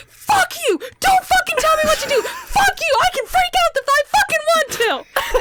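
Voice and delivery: high-pitched furious screamed outburst; retold screaming. Screaming at Ben for telling her to calm down, furious re-enactment. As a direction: scream back in full defiance, re-enacted. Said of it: "literally screaming at the top of my lungs".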